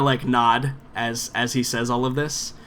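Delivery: normal talking voice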